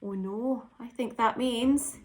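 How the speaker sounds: ordinary delivery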